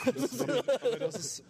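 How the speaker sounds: words squeezed out through a high laugh. Real, not acted. Bandmate cracks up at a harsh joke, laughing mid-word. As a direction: laugh it out, pitch jumping up. Reacting to joke about Westerwelle.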